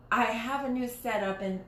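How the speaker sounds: ordinary talk, nothing marked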